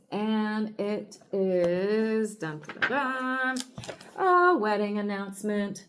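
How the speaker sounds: drawn-out suspense with a sung sting; playful drumroll-style big reveal; mock dramatic reveal. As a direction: stretch the lead-in, then announce it grandly.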